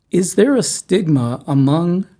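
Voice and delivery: usual speaking voice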